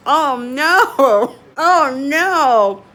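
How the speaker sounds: loud, drawn-out cry of dismay; amused dismay. Real, not acted. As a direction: wail it loudly on the edge of laughter. Dismayed laughing at failed bake.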